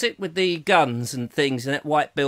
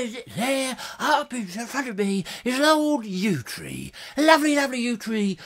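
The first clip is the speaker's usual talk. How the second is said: David Bellamy; 5 semitones higher, 4 dB quieter than usual. Acted. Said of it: "sort of cross between David Bellamy and".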